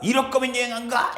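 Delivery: a lightly voiced huffy grandmother; hammed-up grandma sulk; angry grandma. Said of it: "할머니가".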